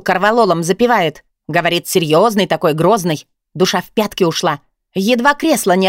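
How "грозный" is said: usual speaking voice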